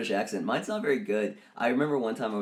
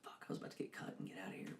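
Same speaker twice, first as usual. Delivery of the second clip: whispered aside; 20 dB quieter, much faster than usual. Retold or imitated. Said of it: "unvoiced whisper".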